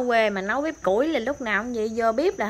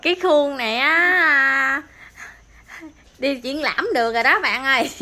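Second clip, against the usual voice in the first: high, loud show-off voice; playful boasting; 5 semitones higher, 7 dB louder than usual. Real, not acted. Vlogger joking to viewers about the homemade mould, boastful. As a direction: boast loudly, drawing out the first words.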